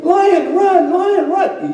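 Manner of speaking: high-pitched frantic sheep cries; hammed-up squeal of alarm; mock screaming. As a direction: cry out high like panicked sheep.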